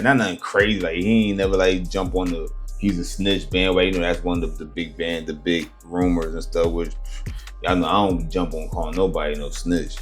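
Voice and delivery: usual speaking voice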